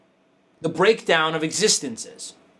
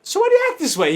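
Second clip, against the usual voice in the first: baffled question; 12 semitones higher, 3 dB louder, faster than usual. Retold or imitated. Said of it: "I'm like".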